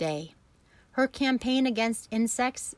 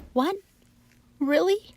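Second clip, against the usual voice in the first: high, loud startled cry; cried out; 10 semitones higher, 5 dB louder than usual. A narrator's reading. Wilbur hears he won't die, crying out high. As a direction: gasp it out in hope. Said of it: "cried Wilbur".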